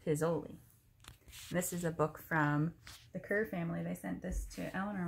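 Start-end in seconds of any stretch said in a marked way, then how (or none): none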